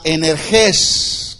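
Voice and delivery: "energés" stressed on its last E; stress on final E. Shown as a demonstration. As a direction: land the accent on the final syllable of "energés". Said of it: "con acento en la E". ("energés" con acento en la última E)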